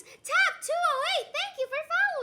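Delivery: loud, high streamer shout-out; excited thanks. Real, not acted. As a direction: belt out the thank-you. Thanking a new follower.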